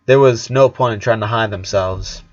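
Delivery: plain reading voice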